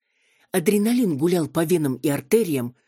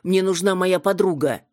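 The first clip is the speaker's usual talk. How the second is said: losing it; 5 semitones higher, 4 dB louder than usual. Acted. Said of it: "Окончательно сорвалась я с резьбы".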